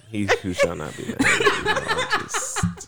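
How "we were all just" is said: laughing. Real, not acted. Laughing over ex-fiance.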